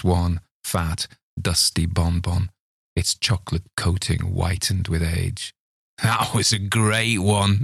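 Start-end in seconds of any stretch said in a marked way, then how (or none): 6.0-7.6 wheezing. Acted. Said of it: "Mister Mullins wheezed".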